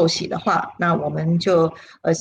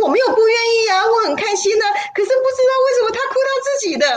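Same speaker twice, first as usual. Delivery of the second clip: much higher, crying while insisting she is happy; tearful protest; much faster than usual. Retold or imitated. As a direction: sob through a confused denial, rushing the last line. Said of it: "对，我说：".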